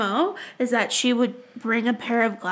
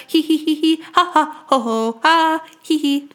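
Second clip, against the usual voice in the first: villain giggle; 3 semitones higher than usual. Pretend. Gloating over costume idea.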